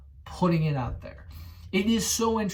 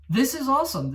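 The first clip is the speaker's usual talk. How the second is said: excited; 9 semitones higher, 5 dB louder, faster than usual. Real, not acted. Thrilled about next-gen graphics.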